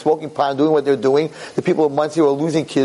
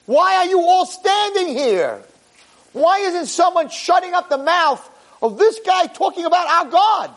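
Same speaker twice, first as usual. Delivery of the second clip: indignant outcry; 11 semitones higher than usual. Acted. David rebukes the standing army.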